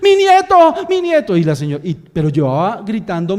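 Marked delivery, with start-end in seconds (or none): 0.0-1.4 put-on high, loud wailing cry of an old woman; screaming grandmother. Old lady wailing to the guards about her grandson. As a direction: wail it like a panicked grandma. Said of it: "y era así".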